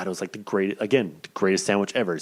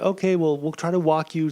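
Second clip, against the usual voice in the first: higher, overly careful nurse voice; higher, sing-song caregiver voice; imitating the nurse; 7 semitones higher than usual. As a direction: play the nurse who thinks he is frail. Nurses voiced in retold story.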